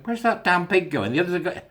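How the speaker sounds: who does he think he is; exasperated herd. Retold or imitated. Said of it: "we're going".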